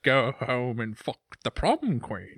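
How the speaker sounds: slow, hammed-up Scottish film-star voice; swaggering Scottish movie-star impression; Sean Connery. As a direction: drag out each word with slushy sh sounds. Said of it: "My Connery?".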